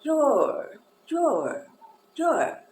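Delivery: your said high as a smooth joined o, like a slur in music; repeating your for students; linked your demo. Shown as a demonstration. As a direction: glide your like a tied note. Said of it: "como se fosse uma ligadura de partitura".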